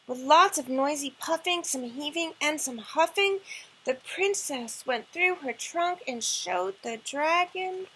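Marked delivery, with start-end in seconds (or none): none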